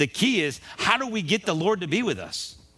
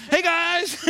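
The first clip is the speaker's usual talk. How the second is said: much higher and louder, bouncy hey guys; mock cheerful greeting; 12 semitones higher, 4 dB louder than usual. Joseph calling up to his brothers from the pit, mock cheerful. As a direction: wave it off like nothing's wrong.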